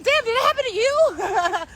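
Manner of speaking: high, loud, laughing exclamation; laughing disbelief. Retold or imitated. Reacting to deaths all around, high laughing disbelief.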